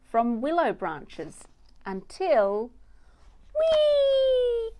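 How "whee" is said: high gleeful whee. A narrator's reading. Little mouse squealing as she swings down. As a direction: squeal it like a thrill ride.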